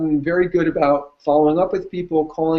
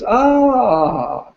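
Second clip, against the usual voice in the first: imitated drawn-out "ah" of realization; higher, long "ahh" as it clicks; aha moment; 8 semitones higher than usual. Client recalling seeing the coach present it, imitated realization. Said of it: "you get those".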